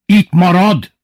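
high, loud order; sudden yell. A narrator's reading. Bubu ordering that Lili stays, sudden yell. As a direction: burst out loudly, forbidding it. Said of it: "üvöltött hirtelen Bubu".

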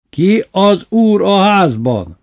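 slow, high, loud demand; tyrant's drawn-out challenge; imperious shout. A narrator's reading. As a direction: bellow it word by word. Master demands homage from slaves.

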